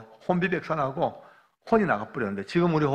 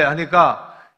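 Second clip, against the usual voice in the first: ordinary talk, nothing marked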